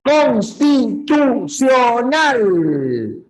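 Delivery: "Constitucional" slowed into five separate beats; "Constitucional" counted out in five punched syllables. Shown as a demonstration. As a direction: hammer out "Constitucional" one syllable at a time. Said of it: "cinco golpes de voz".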